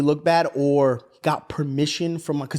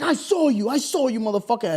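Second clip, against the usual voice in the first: higher-pitched, insistent accusation; retold arguing; 5 semitones higher than usual. Son arguing back at stepdad, accusing. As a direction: press the accusation, half in earnest. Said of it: "he's, like, arguing".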